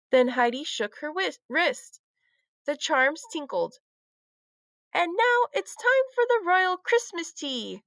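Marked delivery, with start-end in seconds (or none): 4.9-7.8 high proper English accent, playing royalty. A narrator's reading. Heidi playing Christmas queen to Lucy, proper English accent. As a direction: announce it in a grand English accent. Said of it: "in a proper English accent".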